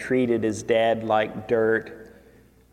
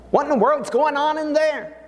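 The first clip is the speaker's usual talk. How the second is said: high, quick, baffled outburst; voiced surprise, pitched up; puzzled exclamation; 14 semitones higher, faster than usual. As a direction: call it out like a startled son. Older son wondering at the noise.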